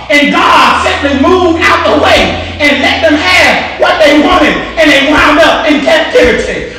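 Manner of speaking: full-force gospel preaching; fiery preaching. Acted. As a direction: preach it out to the congregation. Preaching in repeated calls.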